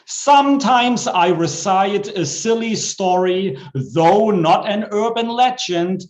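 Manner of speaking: bouncy comic poem reading with a rhyme beat; poem recital. Acted. Poet reciting a comic poem to the open mic. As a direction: perform the silly rhyme for the crowd.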